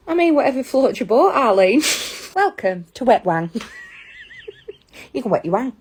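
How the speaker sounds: ordinary talk, nothing marked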